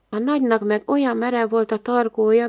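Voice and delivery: ordinary delivery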